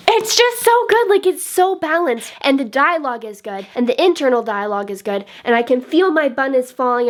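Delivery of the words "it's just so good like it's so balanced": high, excited burst of praise; excited gushing. Real, not acted. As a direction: gush in a raised, delighted pitch. Gushing praise of favourite book.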